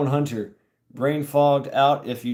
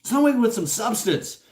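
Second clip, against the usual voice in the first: mock craving; 9 semitones higher than usual. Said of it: "that's what I'm like".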